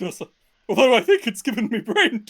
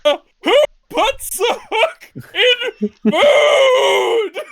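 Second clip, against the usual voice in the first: slow, high, laughing outrage; outraged fish voice; 10 semitones higher, 5 dB louder, much slower than usual. Acted. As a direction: yell it in indignant disbelief. Frank hooked, outraged.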